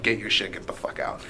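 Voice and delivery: normal talking voice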